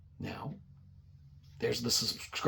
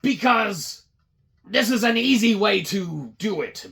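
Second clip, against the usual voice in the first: grand boss voice; 8 semitones higher, 6 dB louder than usual. Retold or imitated. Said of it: "He's like".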